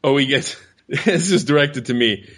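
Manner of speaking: normal talking voice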